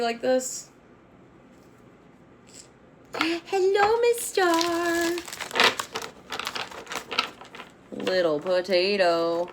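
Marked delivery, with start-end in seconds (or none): 3.2-9.5 high cooing pet voice, then slow; pet talk. Real, not acted. Owner greeting her cat Miss Star, high cooing. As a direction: coo sweetly to the cat.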